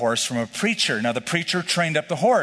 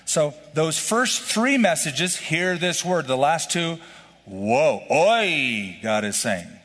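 ordinary delivery

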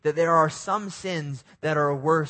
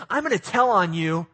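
put-on higher, louder childish taunt; tattletale kid; 5 semitones higher, 5 dB louder than usual. Child threatening to tell on a brother, mock taunting. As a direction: tease like a little sibling. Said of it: "they said".

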